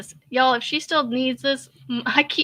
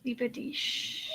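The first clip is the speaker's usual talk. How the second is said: whispered to self; 13 dB quieter than usual. Shown as a demonstration. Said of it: "But you had E, the I C H on there".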